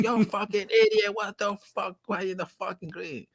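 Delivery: high mock yell at the driver; angry golfers. Storyteller mimicking golfers scolding the pizza guy. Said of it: "They're like".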